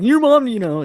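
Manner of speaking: high, loud whine; mock baby whine. Friend shows how the killer doesn't say it, babyish whine. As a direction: exaggerate it like a whining baby. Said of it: "being like a baby".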